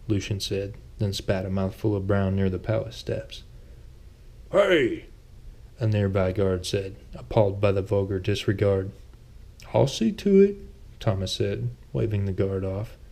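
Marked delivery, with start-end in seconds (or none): 4.5-5.1 guard's shout. A narrator's reading. Guard shouts at spitting Lucian. 9.7-10.6 Thomas. A narrator's reading. Thomas waves the guard off.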